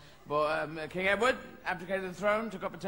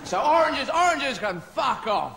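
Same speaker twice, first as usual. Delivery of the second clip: loud rant; 6 semitones higher, 5 dB louder, slower than usual. Real, not acted. Ranting at oranges.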